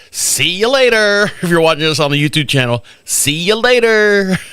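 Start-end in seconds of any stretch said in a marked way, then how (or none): 0.1-1.2 slow, drawn-out See you later; a slow, stiff farewell; mock goodbye. Teller waving off the neighbor, slow mock goodbye. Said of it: "I waved to him like this". 3.0-4.4 slow, stiff See you later; deadpan salute farewell; mock Hitler-style goodbye. Teller repeats wave for YouTube viewers, slow stiff. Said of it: "Hitler style".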